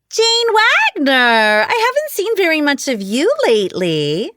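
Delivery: high-pitched, sugary and fake; fake sweet high voice. A narrator's reading. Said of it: "in a fake, friendly, high-pitched voice".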